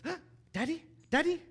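put-on high-pitched little child calling out; gasp, then call excitedly in a small high voice; imitating Gabriel. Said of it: "Gabriel has heard that I'm home".

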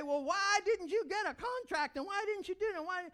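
high, loud nitpicking questions; a hammed-up nitpicker; mock nagging critic. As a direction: needle like a faultfinder. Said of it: "I didn't need someone to say".

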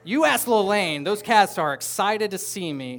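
loud, slow, beaming boast; excited boasting. Real, not acted. Pastor bragging to the congregation about his cats, loud and excited. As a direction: proclaim it like a proud cat owner. Proud cat owner boasting.

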